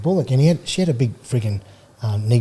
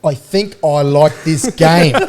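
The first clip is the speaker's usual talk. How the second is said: imitating Sandra; 4 semitones higher, 7 dB louder, much slower than usual. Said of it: "she looked and she went".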